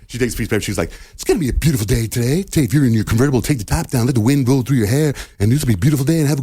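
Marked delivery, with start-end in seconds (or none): 1.1-6.4 lightly hammed-up bubbly weather girl, a bit higher; mock the perky radio forecast, sing-song and sweet; cheesy radio weather voice. Co-host showing the new guy how to read the weather, hammed-up perky forecast voice. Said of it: "I don't talk like that".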